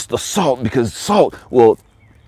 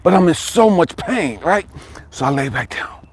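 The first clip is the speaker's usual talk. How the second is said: normal talking voice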